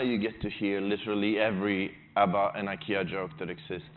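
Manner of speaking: ordinary talk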